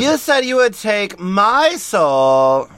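loud, slow indignant cry; protest, feeling cheated; outraged Bella. Acted.

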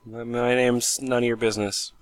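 ordinary talk

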